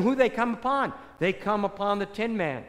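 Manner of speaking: ordinary delivery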